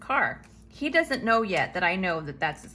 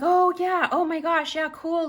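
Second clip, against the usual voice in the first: high gushing oh my gosh, louder; overly nice neighbor; 8 semitones higher, 4 dB louder than usual. Retold or imitated. Neighbor reassures the woman before prom, gushing nice. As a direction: lay on the friendliness thick. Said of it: "totally nice".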